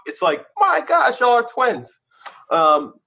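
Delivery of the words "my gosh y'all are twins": high, loud gasped amazement; squeaky mock astonishment; amazed exclaim. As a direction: blurt it out like you've just seen his twin. Quoting people amazed at resemblance.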